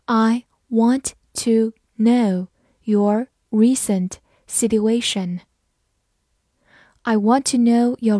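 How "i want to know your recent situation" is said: slow, drawn-out reading. Shown as a demonstration. Teacher slowing the sentence down for learners. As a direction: read it slowly word by word. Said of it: "好，慢慢来读。".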